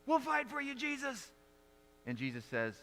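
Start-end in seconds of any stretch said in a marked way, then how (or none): 0.1-1.3 much higher, eager voice of the disciples. Retold or imitated. Disciples offering to fight for Jesus, eager and high. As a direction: pledge to fight with sudden fervour. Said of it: "disciples take out their swords".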